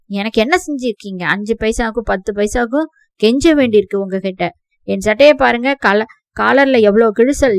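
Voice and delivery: loud, bitter shouted complaint; angry outburst. Acted. Nephew shouts at aunt and uncle, loud bitter rage. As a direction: let years of resentment burst out loud.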